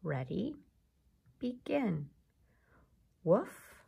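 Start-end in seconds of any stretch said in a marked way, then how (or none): 3.2-3.8 short rising "Woof" like a dog. Shown as a demonstration. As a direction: bark it like a playful dog. Sound ladder drill.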